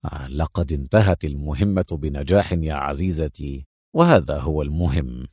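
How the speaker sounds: low, friendly reassurance; affectionate. A narrator's reading. Agent reassuring his cross partner, low and affectionate. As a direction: settle her gently, voice deep. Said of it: "ابتسم في ود".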